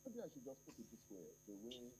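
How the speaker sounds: very faint, distant phone line; barely audible. Real, not acted. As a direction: caller fading almost to nothing. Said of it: "I can barely hear you".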